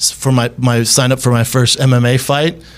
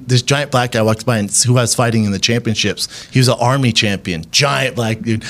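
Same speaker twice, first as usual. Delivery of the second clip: ordinary talk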